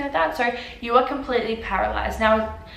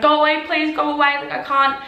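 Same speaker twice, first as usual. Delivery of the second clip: higher, louder imitation of begging; retold panicked pleading; 6 semitones higher, 4 dB louder than usual. Patient to woman playing music in her ear, retold frantic plea. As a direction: re-enact shooing someone off in distress. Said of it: "I was screaming".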